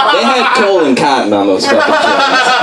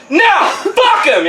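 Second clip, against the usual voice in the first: high, slow mock yell; hammed-up angry heckler; angry outburst; slower than usual. Angry heckler yelling at the worker, mock outburst. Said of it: "some angry asshole to be like".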